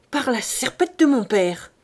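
forceful swearing with a swell on the middle; an angry cooper's curse; thundering oath. A narrator's reading. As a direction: swear it with weight. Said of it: "Quand le tonnelier jurait ainsi".

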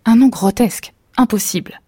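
husband scoffing at a silly name; objecting. A narrator's reading. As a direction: dismiss the name out loud. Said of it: "objecta le mari".

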